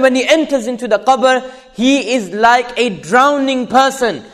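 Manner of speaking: high, loud, fervent sermon; full-force preaching. Acted. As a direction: build the warning at full voice.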